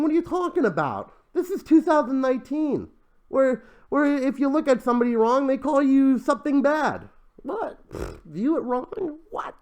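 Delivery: high-pitched, baffled protest; mock incredulity. Vlogger disputing that people view things as wrong, mock incredulous. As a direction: question it in exaggerated disbelief. Mock disbelief at the stated risk.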